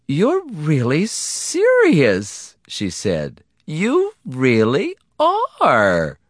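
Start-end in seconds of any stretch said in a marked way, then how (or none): 0.1-2.5 high, very slow wife voice full of wonder. Acted. Wife looks at him with wonder. 3.6-6.2 high, long-drawn wife voice in wonder. Acted. Wife marvels at his seriousness.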